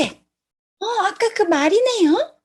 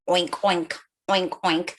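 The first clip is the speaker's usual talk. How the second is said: low, soft nasal oinks; pig oinking; 10 semitones lower, 5 dB quieter than usual. Pretend. Teacher showing kids the pig, low oinking. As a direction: grunt it out like a pig.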